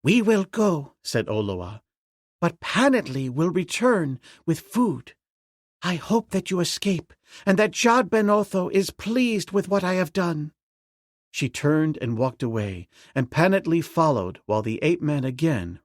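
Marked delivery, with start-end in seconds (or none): 0.0-10.5 put-on high girl's voice; young princess. A narrator's reading. Princess bidding the ape-man farewell in a high voice. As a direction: voice the princess, gentle and hopeful.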